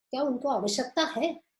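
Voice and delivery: usual speaking voice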